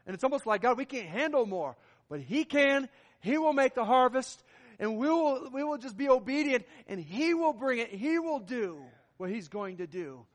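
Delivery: loud, driving sermon delivery; impassioned preaching. Real, not acted. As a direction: preach it out with conviction. Preaches at full force.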